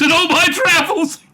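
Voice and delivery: high, loud and cracking up; breaking into laughter. Acted. Reader acting the Doctor's speech, breaking into laughter. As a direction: start the grand line, dissolve into laughing.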